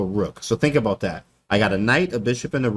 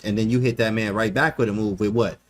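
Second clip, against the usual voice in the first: ordinary talk, nothing marked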